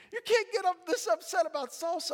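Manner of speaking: scolding father. Retold or imitated. Father scolds crying son.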